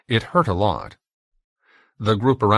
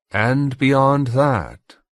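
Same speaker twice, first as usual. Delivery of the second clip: higher, very slow question; probing interrogator; 6 semitones higher, 4 dB louder, much slower than usual. A narrator's reading. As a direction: press for more, drawing out each word. Inquisitor presses for more.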